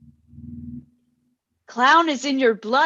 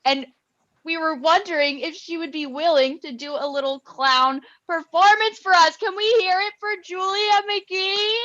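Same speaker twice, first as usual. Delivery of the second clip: rising to a big introduction; hyped announcer build-up; 7 semitones higher, 4 dB louder than usual. Acted. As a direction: pitch it up like an emcee. Host hyping a performer.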